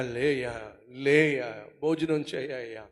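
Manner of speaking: soft, low pleading voice; coaxing servants. Acted. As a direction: gently urge the grieving king to eat. Servants coaxing David to eat.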